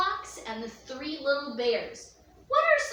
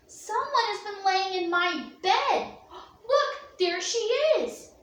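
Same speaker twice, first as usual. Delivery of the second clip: higher, louder excited cub; wee bear, excited; 5 semitones higher, 4 dB louder than usual. Acted. Little bear spotting Goldilocks in his bed, high excited voice. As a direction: gasp and point, thrilled to find her.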